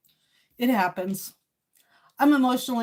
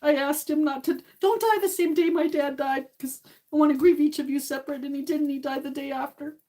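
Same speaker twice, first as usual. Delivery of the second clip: grieving; 6 semitones higher than usual. Real, not acted. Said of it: "I'm just a little emotional".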